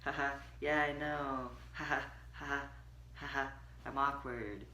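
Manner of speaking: soft awkward giggling thanks; nervous laughing deflection. Retold or imitated. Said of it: "I'm just like".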